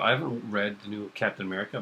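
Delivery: normal talking voice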